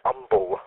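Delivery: "umble" opening on the vowel, no H; umble without H. Shown as a demonstration. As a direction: drop the H in "umble". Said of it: "without the H".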